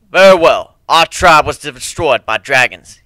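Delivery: loud, slow grave statement; panther leader stating. A narrator's reading. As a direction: state it heavily, giving in. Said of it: "he stated".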